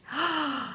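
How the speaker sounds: sharp, high little gasp of delight. Real, not acted. Excited gasp showing viewers the tie-dye spiral. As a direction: catch your breath at something lovely.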